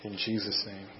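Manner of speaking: soft, reverent prayer, fading at the end; closing prayer. Acted. Pastor praying for the congregation, soft and reverent. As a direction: pray over the congregation, hushed and earnest.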